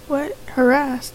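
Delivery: ordinary talk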